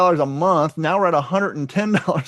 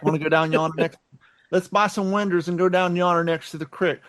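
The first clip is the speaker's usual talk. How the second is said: put-on hillbilly accent; 3 dB quieter than usual. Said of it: "hillbilly accent".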